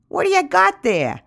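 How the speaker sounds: high, loud figure voice; Bunyan, excitedly curious. A narrator's reading. Bunyan sees the cocoon on the ground, excitedly curious. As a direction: pipe up high and loud, eager to see. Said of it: "Bunyan is completely out of breath".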